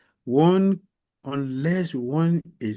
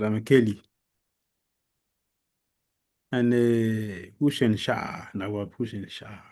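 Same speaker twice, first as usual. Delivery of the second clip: usual speaking voice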